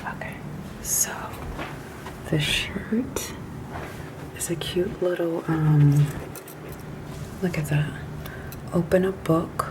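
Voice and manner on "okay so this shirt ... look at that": library whisper. Real, not acted. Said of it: "I'm whispering because people are upstairs asleep".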